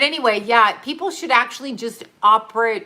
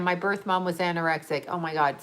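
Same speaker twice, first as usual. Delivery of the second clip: ordinary delivery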